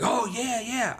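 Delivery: put-on high, excited little-kid yes; eager kid. The little kid eagerly accepting, high kid voice. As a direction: squeak it out like an eager boy.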